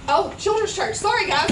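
loud, high call across the room. Real, not acted. Pastor calls the children out to children's church, loud high call. Suddenly remembers kids must leave.

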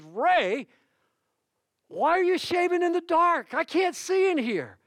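high, loud, incredulous yell; comedian shouting in the dark. Retold or imitated. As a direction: shout it like you can't find him in the dark. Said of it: "this very famous comedian says".

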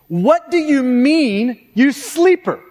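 slow, drawn-out incredulous rebuke; acted exasperated captain scolding a sleeper; sarcastic. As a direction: lean on it with biting sarcasm. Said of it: "sarcasm is at least twenty-eight hundred years".

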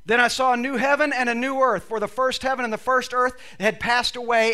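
normal talking voice

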